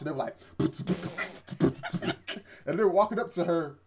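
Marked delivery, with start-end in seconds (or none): 0.6-2.6 imitated beatbox, popping lips. Three guys beatboxing as they walk up to her, imitated beatbox. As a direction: spit out a short mouth-drum beat. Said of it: "they were beatboxing".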